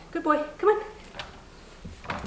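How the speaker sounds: high-pitched, slow praise and coaxing; coaxing a dog. Real, not acted. Trainer praises puppy once whining stops, high coaxing. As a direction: coax the puppy out with a lifted voice.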